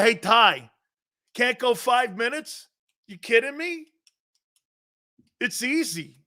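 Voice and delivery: normal talking voice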